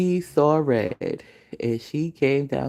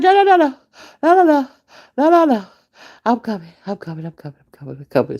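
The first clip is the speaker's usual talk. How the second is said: very high, loud panicked no's fading into a meek I'm coming; panicked pleading; 9 semitones higher, 7 dB louder, faster than usual. Retold or imitated. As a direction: plead frantically, then shrink away. Terrified girl backing down to mother.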